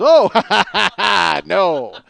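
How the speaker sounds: loud mock burst of laughing; imitated helpless laughter. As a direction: act out someone cracking up mid-answer. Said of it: "Pat couldn't stop laughing".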